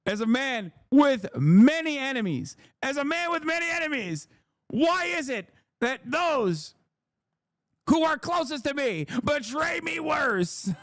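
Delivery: high, strained, melodramatic wail; theatrical betrayed lament. Acted. Streamer laments friends' betrayal, melodramatic high wail. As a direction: cry it out like a tragic victim.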